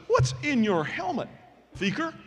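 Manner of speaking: high-pitched disbelieving question; retold suspicious coach; incredulous coach. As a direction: question a kid in rising disbelief. Coach discovers fur in helmet.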